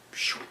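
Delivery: soft imitated "shoo" whoosh; whooshing paper. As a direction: make a quick soft swoosh of falling paper. Imitates paper dropping away.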